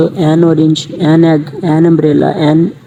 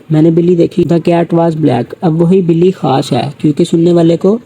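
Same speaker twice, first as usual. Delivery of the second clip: ordinary talk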